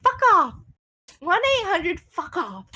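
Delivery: high snappy jab, laughing into it; mock the phone line with a cheeky jab; rude aside. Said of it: "he goes".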